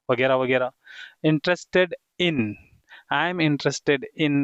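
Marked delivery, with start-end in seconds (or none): 1.3-4.4 slow model sentences; slow dictation. Shown as a demonstration. Teacher modelling names for students, slow and deliberate. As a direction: spell it out slowly for beginners.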